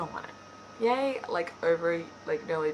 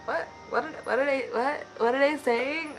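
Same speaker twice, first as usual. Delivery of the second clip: stumbling, higher-pitched confusion; baffled; 7 semitones higher, 3 dB louder than usual. Retold or imitated. Vlogger recalling reading the scene, baffled. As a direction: repeat yourself in retold disbelief.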